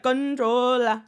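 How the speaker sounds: put-on "[name]" drawled slowly with an island accent, "con-TROH-la"; a mocking Caribbean accent on "[name]". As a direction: stretch out "[name]" like [name]'s fake Caribbean twang. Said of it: "why all of a sudden he's like".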